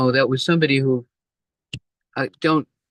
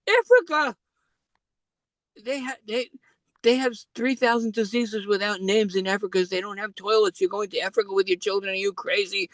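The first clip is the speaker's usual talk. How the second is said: alarmed mother; 10 semitones higher than usual. Retold or imitated. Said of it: "She said".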